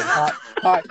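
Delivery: mock Australian greeting, breaking up with laughter. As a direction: try the Australian accent while giggling. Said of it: "let me do an offensive accent".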